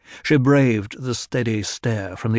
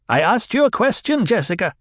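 much higher and louder, sharp and demanding; snappish demand; 11 semitones higher, 3 dB louder than usual. A narrator's reading. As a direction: snap the question at her. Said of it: "The old woman's voice was snappish, demanding".

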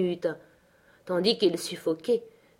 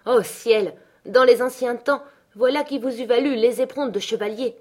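a loud, higher mocking outcry; cried out; 5 semitones higher, 5 dB louder than usual. A narrator's reading. Wife mocking her trembling husband, loud and higher. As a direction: fling it at him in scorn, loud. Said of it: "s'écria madame Linton".

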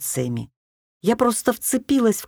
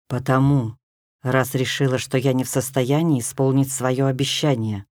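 low male voice; 7 semitones lower than usual. A narrator's reading. Тёмный властелин говорит.

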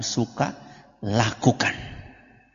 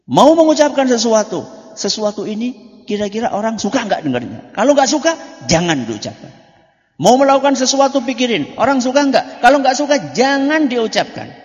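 emphatic preaching; 10 semitones higher, 5 dB louder than usual. Acted. Preacher urges children emphatically.

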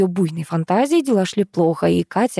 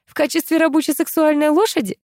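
high-pitched snide jibe; 9 semitones higher than usual. A narrator's reading. Katya goes on needling her friend, snide. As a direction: needle her with a mocking question. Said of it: "принялась ехидничать Катя".

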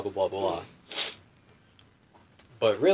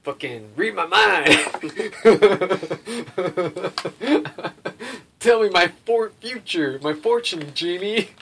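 loud, higher-pitched jackass demand; mock demanding; 9 semitones higher, 9 dB louder, slower than usual. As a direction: bark it as a hammed-up order. Mocks himself as demanding jackass.

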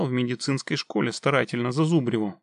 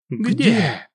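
eager; 7 semitones higher, 6 dB louder than usual. Acted. Both ask at once, eager.

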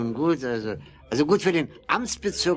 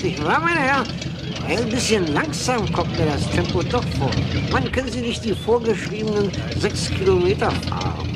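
raised, higher voice calling over the engine; calling out over noise; 8 semitones higher, 5 dB louder than usual. Acted. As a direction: shout forward to the driver, impatient. Official calls to driver in car.

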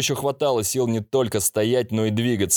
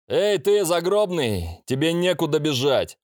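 higher taunting demon voice; fire demon; 7 semitones higher than usual. A narrator's reading. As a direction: taunt him from above, pitched up. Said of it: "прозвучал голос демона".

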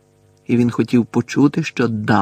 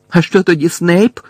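raised, loud demand; heated, higher-pitched shout; shouted challenge; 7 semitones higher, 7 dB louder than usual. A narrator's reading. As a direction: fire the question out loudly. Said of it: "крикнув Гаррі".

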